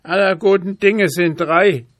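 high pleading raven; begging raven. A narrator's reading. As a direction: coax the boy, raven-like. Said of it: "bat der Rabe". (hohe, bittende Rabenstimme)

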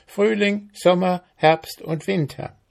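high, officious ant voice. A narrator's reading. Ant questioning the mayfly, officious.

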